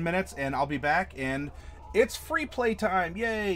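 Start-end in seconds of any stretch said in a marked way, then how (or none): 1.9-3.5 cheerful. Real, not acted. Announcing break cheerfully.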